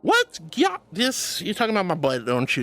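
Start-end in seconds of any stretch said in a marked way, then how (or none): 0.0-1.4 high-pitched squeak of disbelief, dropping after; mock outrage. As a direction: squeal it as if scandalized. Mock outrage at teasing compliment.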